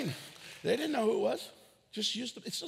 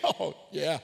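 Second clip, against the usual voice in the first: laughing fib; 3 semitones lower than usual. Retold or imitated. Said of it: "Sometimes I'll lie".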